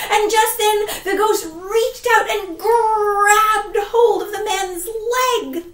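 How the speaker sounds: plain reading voice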